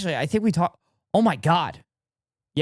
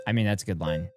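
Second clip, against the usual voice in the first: usual speaking voice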